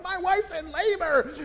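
high, breathless frantic blurt; frantic husband. Retold or imitated. Husband telling security guard, frantic and high. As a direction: panic pouring out. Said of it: "I'm like".

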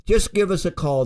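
usual speaking voice

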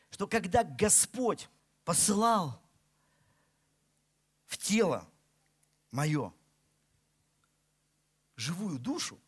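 ordinary talk

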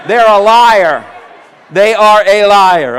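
loud, punched accusation; emphatic shout. Real, not acted. Preacher telling the congregation about those with hands down, shouted.

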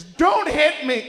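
very high, slow, loud don't hit me; screamed plea. Retold or imitated. Fiancée wakes and screams at a greeting, shrieking. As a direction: shriek like you're being attacked.

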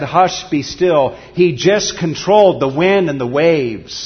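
loud, driving preacher building to a climax; preaching at full force. Acted. Pastor to his flock, preaching hard and loud. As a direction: pound it out to the congregation.

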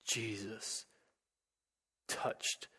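soft, breathy whisper; hushed whisper. Real, not acted. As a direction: drop to a hush to close the story. Climax of the sermon reading.

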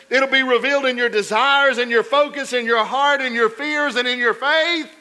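loud, high, relentless preacher cadence; full-force preaching. Acted. Pastor warning the congregation, loud full-force preaching. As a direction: hammer each point home.

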